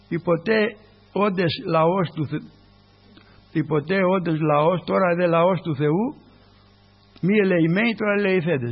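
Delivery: loud, slow proclamation; scripture reading. Acted. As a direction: declaim the verse. Reads scripture aloud in sermon.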